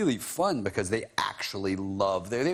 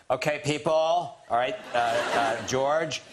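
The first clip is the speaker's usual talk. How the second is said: loud, raised director calling through a speaker; booming director voice; 7 semitones higher, 3 dB louder, slower than usual. Retold or imitated. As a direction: boom it out like a director on a loudspeaker. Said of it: "giant booming voice".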